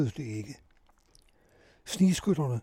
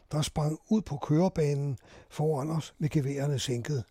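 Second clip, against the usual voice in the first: usual speaking voice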